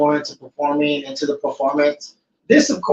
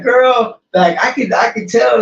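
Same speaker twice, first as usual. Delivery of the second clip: put-on high, louder girly drive-thru voice; 7 semitones higher, 4 dB louder than usual. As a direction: mimic a chatty barista, pitched up.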